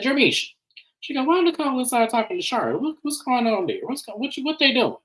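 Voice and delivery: high-pitched nosy busybody imitation; Aunt Nikki. Aunt prying to Jermisha about who's inside, high nosy voice. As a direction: mimic a prying question in a high voice. Acting out nosy aunt's questions.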